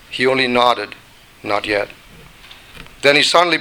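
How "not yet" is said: lower, softer, unhurried master's voice; a patient craftsman saying no, low and quiet; potter's calm refusal. Acted. The potter quietly refuses the teacup, low and calm.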